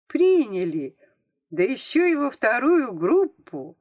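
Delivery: high, loud, thrilled exclamation; delighted realisation. A narrator's reading. Girl realises she is in second group, excited. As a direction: burst out with joyful amazement.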